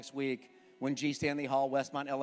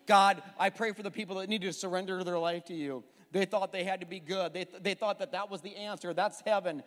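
fervent prayer; 4 semitones higher, 5 dB louder than usual. Acted.

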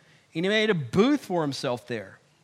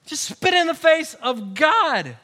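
louder, higher preaching punch; forceful repeat; 7 semitones higher, 6 dB louder than usual. Real, not acted. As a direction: drive it home to the congregation. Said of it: "repeat louder".

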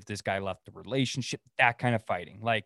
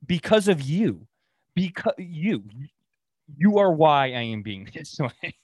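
exasperated retort; 5 semitones higher, 5 dB louder than usual. Real, not acted. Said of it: "answering the hated question".

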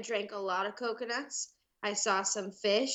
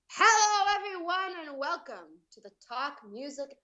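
imitated podcast intro; 5 semitones higher than usual. Guest quoting the intro as her morning alarm, imitated host greeting. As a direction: mimic the show's opening greeting. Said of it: "change my alarm in the morning to".

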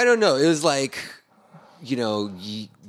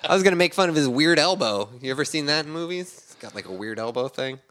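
normal talking voice

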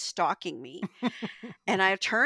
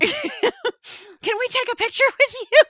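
high, loud, slow gushing fan voice; mock fan begging for a photo; 9 dB louder, slower than usual. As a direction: plead sweetly like an adoring fan. Fans asking for photo.

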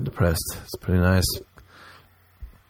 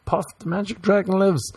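eager tourist; 9 semitones higher, 4 dB quieter than usual. Retold or imitated. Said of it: "They'd be like".

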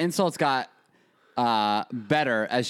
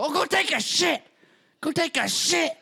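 much higher put-on old Italian grandma. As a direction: squeal it like a cranky old grandma. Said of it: "And she'd go".

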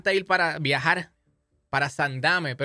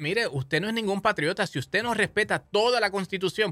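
sharp, higher-pitched mocking rebuke; sarcastic scolding; 5 semitones higher than usual. Real, not acted. Host scolding self-proclaimed patriots who reject the Constitution, sarcastic. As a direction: call out the fake patriots with scorn.

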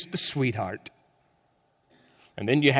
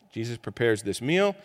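normal talking voice

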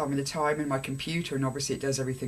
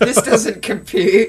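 loud, slow, high mock malfunction; drawn-out cat disbelief, laughing underneath; baffled cat; 6 semitones higher, 13 dB louder, slower than usual. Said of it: "And the cat was like".

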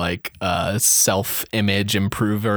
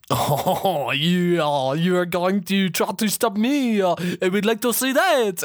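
higher, put-on rival gangster voice; rival gang bat; 6 semitones higher than usual. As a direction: answer the threat as a hammed-up rival bat. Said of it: "the other one's like".

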